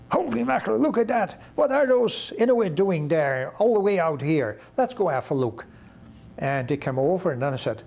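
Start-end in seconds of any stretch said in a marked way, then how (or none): 0.1-5.7 high-pitched amazed exclaiming, gradually settling; sudden astonishment. Retold or imitated. Passing pilot spots stranded men to co-pilot, sudden astonishment. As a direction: start high in surprise, ease down toward the end. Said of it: "one pilot said to the other suddenly".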